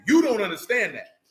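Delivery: usual speaking voice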